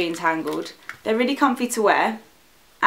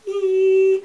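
high excited little squeal; 11 semitones higher, 4 dB louder than usual. Real, not acted. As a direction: squeal with glee over the bag. Teasing the new handbag.

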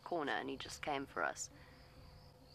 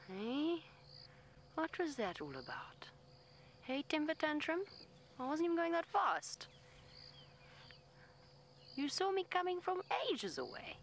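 much higher, indignant telling-off; mock scolding; 11 semitones higher than usual. Real, not acted. Guide tells off the elephant for his tantrum, high indignant scolding.

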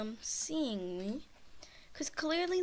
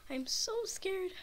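scared whimper; 7 semitones higher than usual. Real, not acted.

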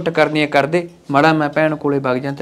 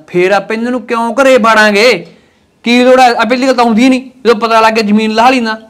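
angry raised voice; 5 semitones higher, 5 dB louder than usual. Acted. Husband scolding wife in quarrel.

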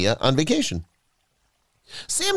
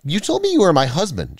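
higher-pitched, halting disbelief; falter, then accuse in shock; stunned woman, voice pitched up; shocked gasp; 7 semitones higher than usual. A narrator's reading. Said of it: "she gasped".

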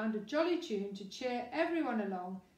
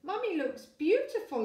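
put-on higher, louder eager child; exclaiming boy; 6 semitones higher, 5 dB louder than usual. A narrator's reading. Son defending his mum, exclaiming loudly. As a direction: blurt it out like an eager little boy. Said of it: "exclaimed Lester".

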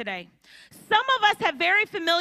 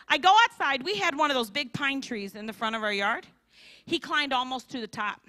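usual speaking voice

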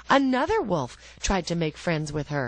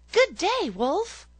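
put-on high, bright child voice; the girl greeting the wolf, high and loud; little girl; 14 semitones higher, 4 dB louder, slower than usual. A narrator's reading. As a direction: answer like a trusting little girl.

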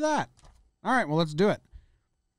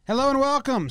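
upbeat greeting; 8 semitones higher, 4 dB louder than usual. Acted. Show opener.

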